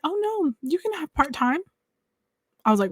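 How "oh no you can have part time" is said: lady reassuring. Retold or imitated. Voicing the lady reassuring her.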